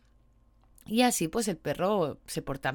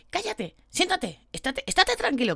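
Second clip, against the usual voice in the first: mock scolding; 9 semitones higher than usual. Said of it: "le gritan para que se calme".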